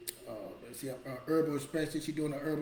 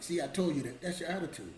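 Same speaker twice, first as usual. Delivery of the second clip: ordinary talk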